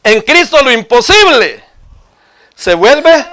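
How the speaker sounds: high, loud preaching cry; emphatic proclamation. Real, not acted. Preacher declaring Christ to the congregation, emphatic proclamation.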